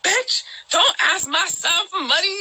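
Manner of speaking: hammed-up angry mom voice; imitating the mama's shout. Mama yelling at a woman asking her son for money, mock shout. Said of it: "she was like".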